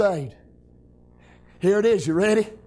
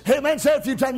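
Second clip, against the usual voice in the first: usual speaking voice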